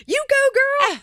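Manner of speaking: high, loud cheering voice. Retold or imitated. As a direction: squeal it out like a fan. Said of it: "he was like".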